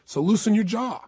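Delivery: usual speaking voice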